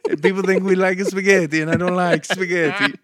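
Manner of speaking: high, loud mock Italian; Sal. Italian coworker griping to colleague, high Italian accent. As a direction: complain in an exaggerated Italian accent. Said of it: "There literally is an Italian".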